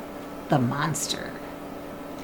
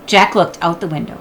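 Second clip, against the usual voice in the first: ordinary delivery, nothing marked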